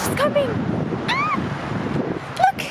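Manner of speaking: high, fast thrilled cheering; overjoyed squeals to the cat; excited. Real, not acted. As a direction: cheer the kitten running in. Cat runs to her in snow.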